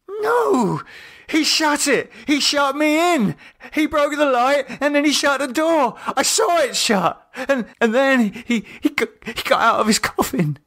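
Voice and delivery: high, crying, panicked rush; hysterical. A narrator's reading. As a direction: babble in sheer terror. David hysterical.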